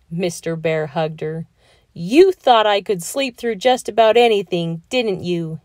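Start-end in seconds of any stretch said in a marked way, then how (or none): 1.9-5.6 louder, higher boom; husband gloating to wife. A narrator's reading. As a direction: boom it out proudly. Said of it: "he boomed".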